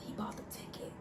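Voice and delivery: hushed, voiceless disbelief; retold shock under the breath; stunned whisper. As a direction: whisper it as if your heart just dropped. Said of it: "my heart dropped".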